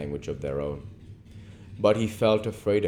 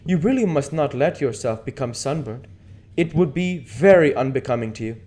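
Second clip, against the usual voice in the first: louder, lifted teasing aristocrat; Lord Henry voice; 9 semitones higher, 8 dB louder than usual. Acted. Lord Henry teasing Dorian about sunburn, louder lord voice. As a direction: chide him like a playful lord.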